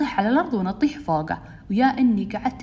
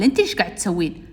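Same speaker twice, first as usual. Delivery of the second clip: retold boy's retort; 2 semitones lower than usual. Boy throws her question back.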